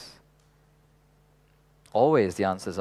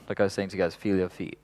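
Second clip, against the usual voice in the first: normal talking voice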